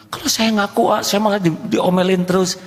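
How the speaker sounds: high, whiny excuse; a hint of a whiny husband; whining husband. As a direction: plead that he'll only get scolded.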